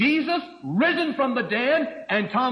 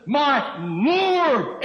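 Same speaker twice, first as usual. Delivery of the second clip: raised voice, long emphatic hold on Lord; emphatic exclamation; 5 semitones higher, 5 dB louder than usual. Acted. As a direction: LORD cried out loud, high and drawn out.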